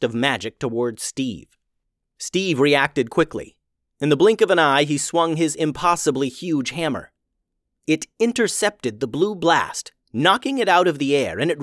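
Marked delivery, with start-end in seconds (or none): none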